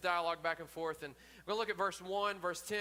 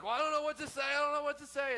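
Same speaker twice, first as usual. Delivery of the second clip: flustered Moses; 10 semitones higher, 6 dB louder, faster than usual. Retold or imitated. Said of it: "And Moses is like".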